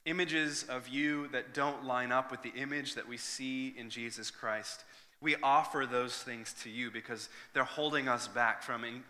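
usual speaking voice

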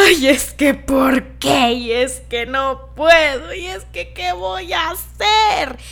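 slow, whiny mock lament; mock despair. Mocking her own heartbroken self, slow and whiny. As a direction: ham up the hopeless sulking. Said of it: "ya dijimos".

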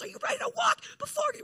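high, rallying pastor voice; hype pastor. Retold or imitated. Pastor to congregation at the bridge, high rallying call. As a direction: pump up the crowd like a worship pastor.

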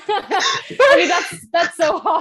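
laughing through the words. Real, not acted. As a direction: talk through a big laugh. Laughing at the cold joke.

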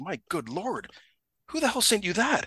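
high-pitched retold shock; taken aback. Supervisor shown a lewd photo, taken aback. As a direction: demand it in startled disbelief. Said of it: "He takes him aback".

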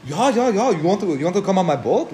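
high-pitched, badly faked German promoter; terrible German accent. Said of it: "that's a terrible German accent".

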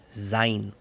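German "sein" with the diphthong said as "ai"; pronunciación alemana. Shown as a demonstration. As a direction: say "sein" like "zain", voiced z. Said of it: "que es sein". («sein» pronunciado a la alemana, como «zain»)

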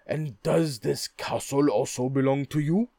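loud, startled royal cry; surprised king. Acted. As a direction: exclaim it in royal astonishment. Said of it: "cried the king".

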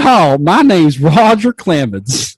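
slow loud cowboy Texan twang; Texas accent. Acted. Roger Clemens as a mock Texan, hammed-up drawl. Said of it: "Roger Clemens is from, like, Texas".

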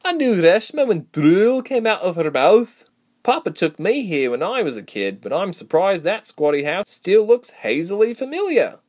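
high, loud storybook character voice; playful banter between old acquaintances; animated, teasing reminiscence. A narrator's reading. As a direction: reminisce with lively, swooping pitch.

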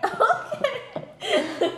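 laughing. Real, not acted. Reacting to creepy story, laughing high. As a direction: laugh the word out.

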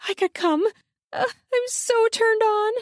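high, panting excitement. A narrator's reading. Woman increasingly aroused, panting. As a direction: pant it out up high. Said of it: "Her panting increases".

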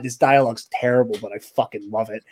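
ordinary delivery

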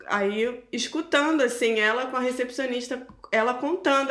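ordinary talk, nothing marked

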